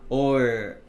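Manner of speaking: normal talking voice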